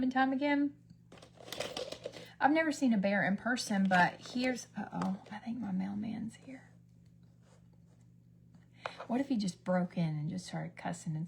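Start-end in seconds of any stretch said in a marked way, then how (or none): 4.8-6.6 soft unvoiced uh-oh; whisper. Real, not acted. Hearing the mailman at the door, whispering. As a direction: hush it as someone arrives.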